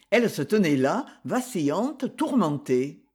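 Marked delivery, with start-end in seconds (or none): none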